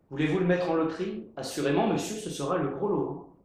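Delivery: fast, brisk salesman; practical. Acted. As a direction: rattle it off briskly.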